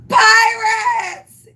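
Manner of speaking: loud, high playful cry; silly yell. Retold or imitated. On the boat, yelling at the approaching warden boat, high silly shout. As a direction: yell it out of silliness. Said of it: "So I yell out, out of silliness".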